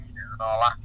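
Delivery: ordinary talk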